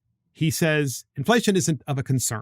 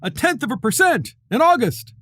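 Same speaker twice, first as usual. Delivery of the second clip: mock news headline; 9 semitones higher, 4 dB louder than usual. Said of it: "So C N B C is like".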